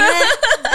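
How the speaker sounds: squealing high laugh. Real, not acted. Laughing at no dates. (甲高い笑い声)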